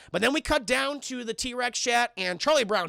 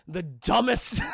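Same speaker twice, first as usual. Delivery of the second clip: usual speaking voice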